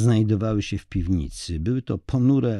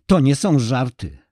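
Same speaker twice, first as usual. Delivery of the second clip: higher, louder, desperate pressing; wife losing patience over missing Ola, higher and louder; angry, strained; 6 semitones higher, 5 dB louder than usual. Acted. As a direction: push him hard, near breaking. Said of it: "wycedziła przez zęby".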